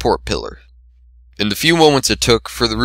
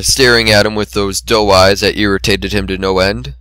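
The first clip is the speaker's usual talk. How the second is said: plain reading voice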